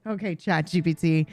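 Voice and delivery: ordinary delivery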